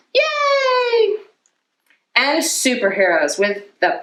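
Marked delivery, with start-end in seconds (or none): 0.1-1.2 high gleeful yay; cheer. Acted. A pirate cheering over treasure, high squeal. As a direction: squeal with joy.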